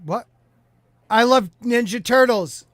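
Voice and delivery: ordinary talk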